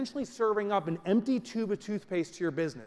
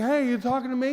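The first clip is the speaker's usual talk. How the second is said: tough-guy toothpaste; 6 semitones higher, 5 dB louder than usual. Acted.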